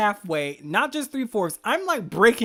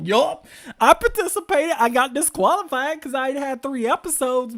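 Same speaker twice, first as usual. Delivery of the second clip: loud, high and gleeful boast; proud exclaim; 5 semitones higher, 7 dB louder than usual. Retold or imitated. Boasting to viewers about entering anyway, loud and gleeful. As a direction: brag about getting disqualified. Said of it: "And I'll be like".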